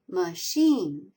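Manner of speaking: "Machine" enunciated with stress on the second syllable; stress on SHEEN. Shown as a demonstration. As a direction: say "Machine" carefully, leaning on chine. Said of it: "Sheen is accented".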